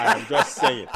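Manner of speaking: laughing African accent, slow and loud; drawled out in an African accent through a laugh. Real, not acted. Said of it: "You just made my African accent come out".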